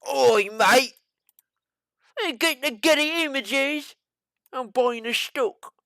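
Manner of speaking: hammed-up Cockney from a Yank; American British accent. Host joking about British buyers, mock British accent. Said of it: "American British".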